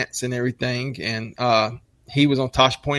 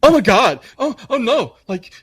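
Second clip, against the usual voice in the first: high, squeaky panicked whine; mocking panicked kid; 7 semitones higher, 4 dB louder than usual. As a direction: mimic a fussy young guy in a panic. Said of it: "they're like".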